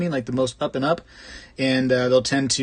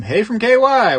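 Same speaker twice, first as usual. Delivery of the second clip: cheerful greeting; 10 semitones higher, 8 dB louder than usual. Real, not acted. Greeting a viewer on stream.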